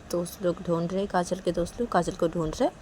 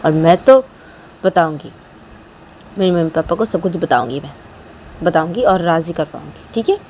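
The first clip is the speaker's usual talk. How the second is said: louder, firm questioning; insistent Kajal; 14 dB louder than usual. Acted. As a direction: push her past the fear. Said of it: "तो काजल बोलती".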